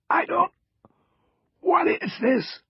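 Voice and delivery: halting, strained rasp; raspy. A narrator's reading. Cross answers the agent questioning him, raspy. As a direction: rasp it out in broken pieces. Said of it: "Cross rasped".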